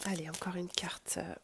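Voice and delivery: soft unvoiced whisper. Real, not acted. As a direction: whisper it to the cards. Whispering while drawing a card.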